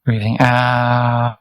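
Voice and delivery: ordinary talk, nothing marked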